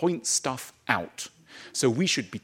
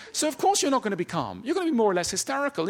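rising agitation; 8 semitones higher, faster than usual. Real, not acted. Building agitation.

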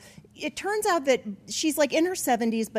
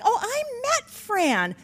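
gushing praise; 9 semitones higher than usual. Retold or imitated. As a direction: gush fondly over an old acquaintance. Barb gushing about Fran.

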